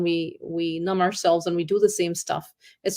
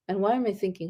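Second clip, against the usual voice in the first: normal talking voice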